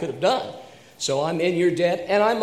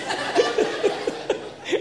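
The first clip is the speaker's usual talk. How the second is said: laughter. Real, not acted. Laughing at own story.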